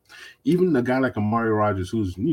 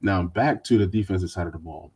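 ordinary delivery

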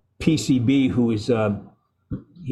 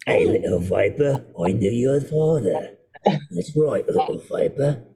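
put-on South African accent; slower than usual. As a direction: drawl it like a South African mercenary. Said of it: "it was a South African accent".

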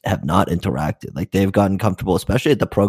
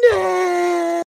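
very high mock wail; hammed-up, high-pitched bawl; freaking out. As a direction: imitate a panicking child crying out. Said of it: "freaking out".

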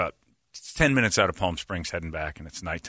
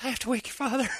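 a much higher, strained voice, imitating mom; playing the panicked mother, pitched way up; mom near tears; 14 semitones higher, 5 dB quieter, faster than usual. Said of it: "And then she goes".

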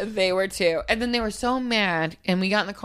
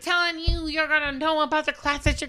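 stern parent; 7 semitones higher than usual. Retold or imitated. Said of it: "turned into a parent".

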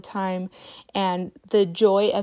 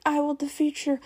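higher, hammed-up villain threat; mock battle cry; 7 semitones higher than usual. Mom fighting the porta potty lock, hammed-up threat. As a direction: playfully vow to beat the lock. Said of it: "and I'm like".

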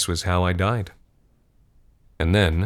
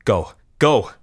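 urgent shout, higher and louder on the second; 4 semitones higher, 5 dB louder than usual. Acted. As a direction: yell for a companion to run. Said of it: "I shouted".

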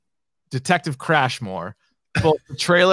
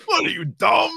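laughing; 3 dB quieter than usual. Retold or imitated. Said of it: "already laughing".

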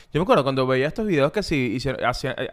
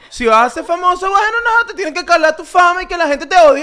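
high, loud mock-outraged rant; indignant hater; 10 semitones higher, 5 dB louder than usual. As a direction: scold the famous person like an online critic. Acts out an indignant online hater.

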